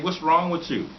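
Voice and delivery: high, indignant mock yell; exasperated. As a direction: throw it back at him in disbelief. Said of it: "It's like".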